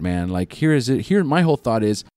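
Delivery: ordinary delivery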